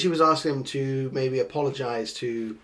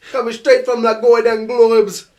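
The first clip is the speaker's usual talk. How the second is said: slow, laughing mock patois, louder and higher; laughing Jamaican patois imitation; 9 semitones higher, 11 dB louder than usual. Podcast host imitates the clip's patois while laughing. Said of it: "It's the way he says globes".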